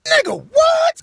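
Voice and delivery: very high, loud disbelief; high incredulous shout; shocked yell. Acted. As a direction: shriek it in comic shock. Shocked shout at daughter's reply.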